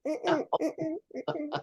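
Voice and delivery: high squeaky dolphin clicks; dolphin noise. Retold or imitated. Answering host's dolphin question, high dolphin squeaks. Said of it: "It's like".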